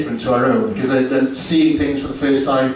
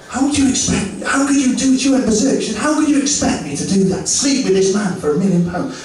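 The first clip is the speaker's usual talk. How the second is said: higher indignant wife voice; mock outraged wife; 7 semitones higher than usual. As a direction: play the shocked wife, pitched up.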